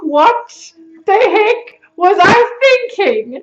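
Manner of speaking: loud, high, very slow "What the heck was I thinking"; exasperated disbelief. Acted. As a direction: hammer out each word in mock horror. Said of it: "look back now and think".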